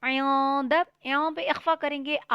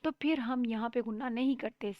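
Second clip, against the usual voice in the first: ordinary talk, nothing marked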